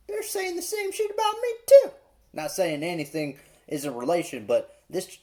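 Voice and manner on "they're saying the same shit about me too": high squeaky mock voice. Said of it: "I'm like".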